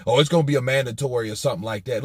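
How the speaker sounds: fast, offhand mimicry of a dodge; dismissive mock quote. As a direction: rattle it off like a lame excuse. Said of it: "Where the fuck you hear that from".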